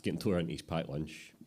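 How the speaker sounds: ordinary delivery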